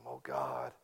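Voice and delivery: imitating a deep, quiet prayer moan; crying out. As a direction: moan it low to God. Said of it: "she'll call out".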